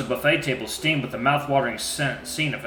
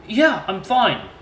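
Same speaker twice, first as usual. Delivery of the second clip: half-hearted mumble; 9 semitones higher, 5 dB louder, much slower than usual. Acted. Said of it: "Cecil mumbled half-heartedly".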